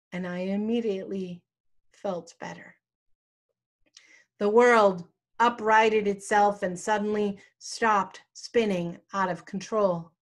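usual speaking voice

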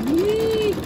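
a sudden high, loud yelp; startled yell. Real, not acted. Quilter sees fabric fail under the needle, loud startled yell. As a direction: yelp out as the stitching goes wrong. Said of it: "Sorry if I yelled that loud".

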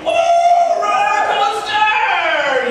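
very high, loud, drawn-out cheer; family thrilled. Retold or imitated. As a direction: shriek it with delight. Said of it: "you can see my family".

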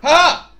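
short high cry of frustration; frustrated yell. Real, not acted. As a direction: let out a loud cry. Outburst during fight.

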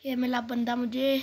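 ordinary talk

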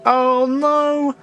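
high sudden oh no; dismayed oh no. Real, not acted. Gamer realising the long walk back, dismayed. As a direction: blurt out the dismay.

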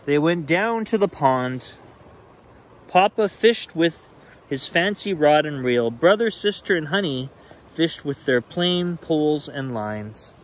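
lively bedtime read-aloud; animated picture book reading; story narration. A narrator's reading.

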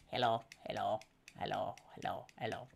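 low croaky frog puppet greeting; deep little frog saying hello. Acted. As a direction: make the paper frog talk low and silly. Small frog puppet talks.

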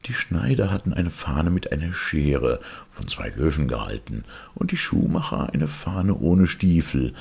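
ordinary delivery, nothing marked

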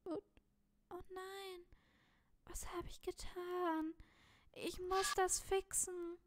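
high, hushed horrified voice; acted dismay. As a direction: lament your own mistake in a high character voice. Figure horrified at own deed.

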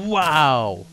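loud amazed exclamation; a real burst of amazement; surprised wow. Real, not acted. Reacting to a great item.

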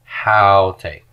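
Haute with an audible h, stress on the first syllable; HOW-tay, breathy onset and leading stress; rough breathing demo. Shown as a demonstration. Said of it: "we have a rough breathing right here".

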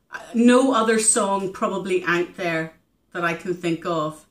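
ordinary delivery

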